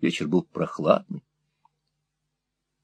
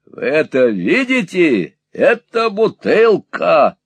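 slow, high, loud demonstration; loud, over-clear; 8 semitones higher, 6 dB louder, slower than usual. A narrator's reading. Squeers shows deaf Peg the bottle, loud and slow. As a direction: spell it out loudly and slowly.

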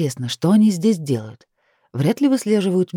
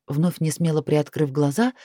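usual speaking voice

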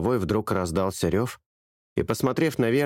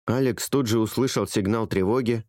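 plain reading voice

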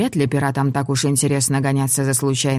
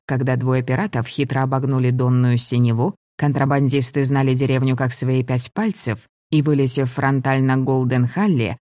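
usual speaking voice

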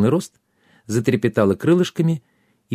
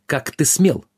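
higher, mildly outraged cry; indignant exclamation; 5 semitones higher, slower than usual. A narrator's reading. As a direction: demand it with a touch of outrage. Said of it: "Воскликнул".

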